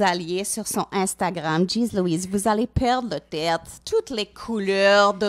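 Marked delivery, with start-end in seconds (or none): none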